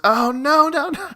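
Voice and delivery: high, hammed-up squeal; mock scream. Woman screaming no back at the man. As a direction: shriek it like a bad actress. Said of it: "She goes".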